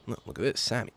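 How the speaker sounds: hint of a mellow father admiring breakfast. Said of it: "he has a d-, deeper voice, too".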